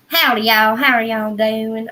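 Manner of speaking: joke-thick Southern twang; harder Southern accent. Pretend. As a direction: exaggerate your own Southern accent for a laugh. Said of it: "it comes out harder".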